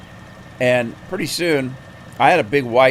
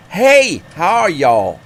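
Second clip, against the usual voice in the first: loud, high Southern "hey" easing into friendly chat; hammed-up Southern trucker on the CB; outrageous Southern accent; 4 semitones higher, 4 dB louder than usual. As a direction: invite a stranger over in a big Southern accent. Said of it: "this guy was talking like".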